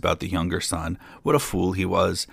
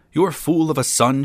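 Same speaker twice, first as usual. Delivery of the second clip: higher, louder outraged wife; furious cry; 6 semitones higher, 4 dB louder than usual. A narrator's reading. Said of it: "she cried".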